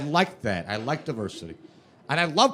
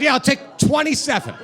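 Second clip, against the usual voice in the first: excited eager reply; 10 semitones higher than usual. Retold or imitated. Eager reply to beer deal.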